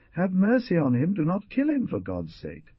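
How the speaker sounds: high desperate plea fading to a breath; pleading. A narrator's reading. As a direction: beg for his life. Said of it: "she exclaimed, clutching her hands together".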